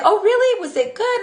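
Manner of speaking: high, eager question; surprised friend. Retold or imitated. The friend asking about the movie, eager and high. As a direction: sound surprised and curious.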